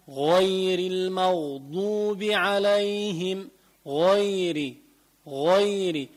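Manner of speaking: slow recitation, غير with a backed, rounded "ghoy"; heavy غ. Shown as a demonstration. Teacher modelling recitation for students, heavy rounded غ in غير. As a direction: thicken both غ in غير المغضوب. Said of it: "الغين تفخم".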